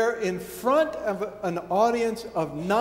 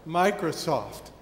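normal talking voice

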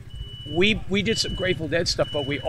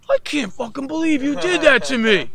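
high, loud furious impression of a betrayed bandmate; hammed-up furious shout; outraged yelling; 11 semitones higher, 8 dB louder than usual. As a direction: yell it down the phone in outrage. Acting out Joey's furious phone call.

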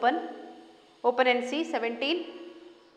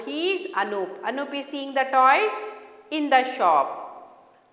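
ordinary talk, nothing marked

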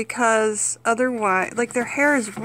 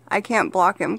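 usual speaking voice

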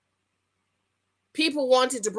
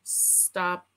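usual speaking voice

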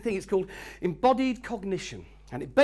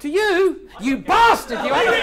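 mock insult; 9 semitones higher, 10 dB louder, slower than usual. Mock insult, teasing delegate.

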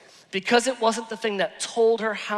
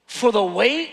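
normal talking voice

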